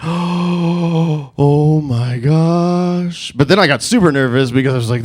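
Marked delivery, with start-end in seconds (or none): 0.0-3.3 very slow, drawn-out awe; mock amazement, long held words; stunned realization. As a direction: stretch each word as it dawns on you. Sudden realization on the date.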